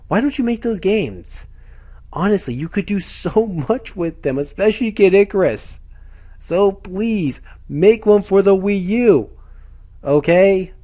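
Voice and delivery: worked-up, frustrated begging; frustrated pleading. Real, not acted. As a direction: plead with them in real frustration. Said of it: "very frustrating".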